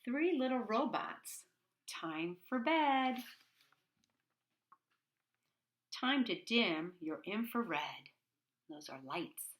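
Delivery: slow, sing-song bedtime reading; reading to children. A narrator's reading. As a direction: read it gently like a bedtime rhyme. Reading the rhyming story aloud.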